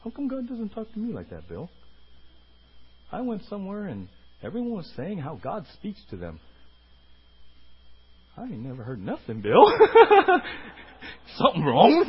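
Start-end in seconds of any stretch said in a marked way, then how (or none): 0.0-9.7 low, soft mock inner whine. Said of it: "Inward, you're like". 9.7-10.7 burst of laughter. Real, not acted. Speaker laughs at own bit.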